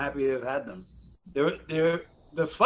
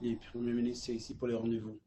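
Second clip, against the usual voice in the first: low, soft Quebec French; real French; 5 semitones lower, 12 dB quieter, faster than usual. Retold or imitated. Bodyguard reporting into his hand, understated real French. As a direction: deliver it quietly in genuine Quebec French. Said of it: "knows how to speak real French".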